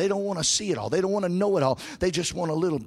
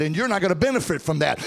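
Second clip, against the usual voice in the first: usual speaking voice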